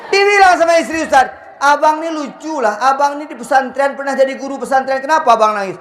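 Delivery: high teasing wife voice; mock wife voice. High far voice mocking wife. (istri menggoda dengan suara tinggi)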